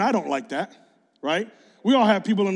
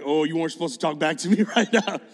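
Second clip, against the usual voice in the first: startled, half-laughing; low, amused disbelief; laughing surprise; 4 semitones lower than usual. Retold or imitated. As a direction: caught off guard, laughing it off. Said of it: "And you're like".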